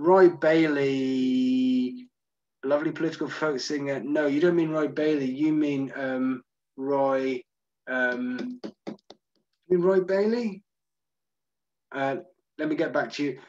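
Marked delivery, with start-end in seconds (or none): none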